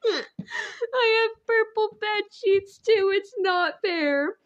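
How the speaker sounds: high, drawn-out whine; mock whining. Real, not acted. Reader protesting to friend about the bed sheets, whining.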